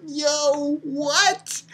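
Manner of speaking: high, soft incredulous exclamation; amazed disbelief. Retold or imitated. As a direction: react in hushed, high-pitched amazement. Reenacts own amazed reaction.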